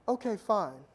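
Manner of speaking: ordinary talk, nothing marked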